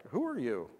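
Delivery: mild imitated fear, voice dropping back down; a little scared. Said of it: "scared, like".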